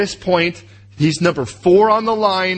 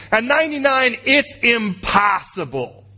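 slow drawn-out emphasis; 8 semitones higher than usual. Real, not acted. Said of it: "dramatic slowing".

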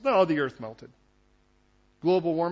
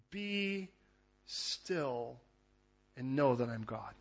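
very soft, slow scripture reading with long pauses; hushed, spacious "be still"; gentle stillness; 9 dB quieter, much slower than usual. Acted. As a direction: let each word settle quietly. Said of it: "It wasn't like he said".

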